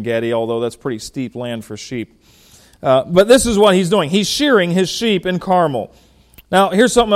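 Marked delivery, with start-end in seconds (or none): none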